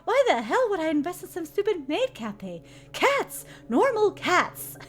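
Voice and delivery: loud, outraged and high; indignant shout. Acted. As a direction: yell it like a furious boss. Villain outraged at maid cafe.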